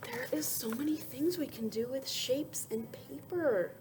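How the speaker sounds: soft awed whisper; whispered wonder. Acted. Whispered wonder after story.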